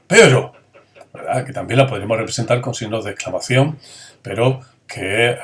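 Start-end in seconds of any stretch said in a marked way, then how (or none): 0.1-0.5 loud, high menacing warning; teacher demoing a threat; threatening. Shown as a demonstration. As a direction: threaten the listener. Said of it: "la de amenaza".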